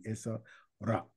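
"ra" avec un r roulé de la langue; arabic r sound. Shown as a demonstration. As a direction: roule le r de "ra" comme en arabe. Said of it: "ta voix résonne comme l'arabe". ("ra" prononcé avec un r roulé, à l'arabe)

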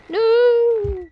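high sharp cry of dismay at a failed jump; dismayed shout. Real, not acted. Player yelling at the game as the character falls, in dismay. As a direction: yell as the jump goes wrong.

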